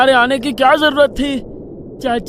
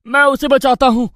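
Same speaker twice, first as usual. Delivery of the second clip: usual speaking voice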